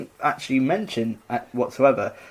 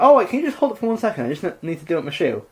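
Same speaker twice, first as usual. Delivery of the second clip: quick sneaky request; 4 semitones higher, faster than usual. Retold or imitated. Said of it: "Hercules is like".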